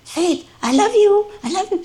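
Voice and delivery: very high, sing-song coaxing. Retold or imitated. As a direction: coax a dog to talk, way up high. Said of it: "I keep trying to encourage her".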